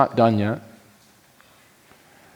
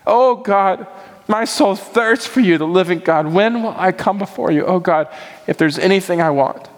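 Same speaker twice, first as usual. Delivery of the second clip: higher, earnest, pleading prayer voice; prayerful psalm reading; 7 semitones higher than usual. Acted. Pastor praying a psalm to the congregation, raised and earnest. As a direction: cry out the psalm to God. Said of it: "Psalm forty-two".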